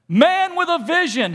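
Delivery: high, loud ringing call; shouted proclamation. Acted. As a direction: announce it like a title. God shouting out to you.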